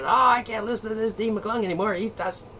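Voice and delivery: high, prim mock old lady voice; little old lady. Mocking a prudish old lady complaining, high prim voice. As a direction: lightly play a shocked old lady. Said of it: "the little old lady said".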